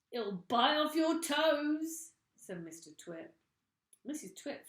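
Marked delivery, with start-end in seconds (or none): 0.1-2.1 slow, loud and high gloating; menacing tease. Acted. Mister Twit gloating to his terrified wife. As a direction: draw it out to scare her.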